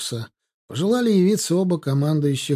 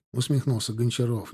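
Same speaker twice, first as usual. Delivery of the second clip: plain reading voice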